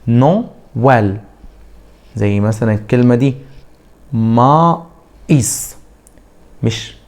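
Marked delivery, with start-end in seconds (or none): 0.1-1.2 نو ويل said slowly, with a clean gap between the syllables; split, slowed. Shown as a demonstration. Teacher to students, slowly splitting نو ويل. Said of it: "وبعمل فصل كده". 4.1-5.8 ما إيس with a break before إيس; split syllables. Shown as a demonstration. Teacher to students, showing the pause in ما إيس. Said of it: "في وقفة كده صوتية".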